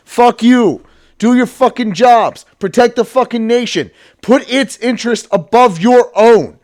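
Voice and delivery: loud, high, furious yelling; furious rant. Real, not acted. Ranting at cowardly politicians, loud and furious. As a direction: yell it at them with real rage.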